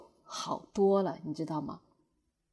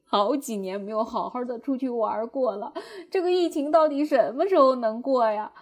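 retold breakdown; 3 semitones higher, 5 dB louder than usual. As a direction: re-enact a plaintive meltdown, voice raised. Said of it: "我又崩溃了".

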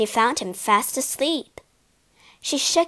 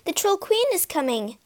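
higher voiced urgent Asta; urgent warning; 8 semitones higher than usual. A narrator's reading. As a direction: warn him, pitched up. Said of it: "urged Asta".

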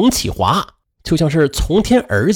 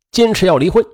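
plain reading voice

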